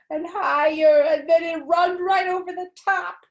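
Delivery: high, loud rising alarm; excited panic. Acted. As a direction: shout it as the water spills over. Toilet overflowing.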